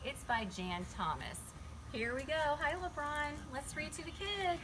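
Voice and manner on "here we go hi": plain reading voice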